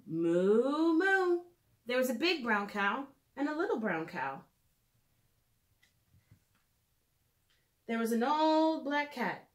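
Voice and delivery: lilting storybook read-aloud; gentle storytime narration for little listeners; reading to children. Acted. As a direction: read it like a bedtime picture book. Reads picture book to children.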